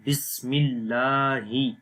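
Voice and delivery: slow, syllable-by-syllable بسم اللہ with a long 'laa'; slow model reading. Shown as a demonstration. Teacher introducing the tasmiya, slow careful recitation. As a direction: draw out each part of بسم اللہ. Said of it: "اب تسمیہ پڑھتے ہیں".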